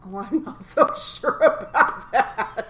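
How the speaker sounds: loud, high, words broken by laughter; laughing disbelief. Real, not acted. Co-host doubts that farting stays safe, laughing disbelief. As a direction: crack up while objecting. Cracks up at safety claim.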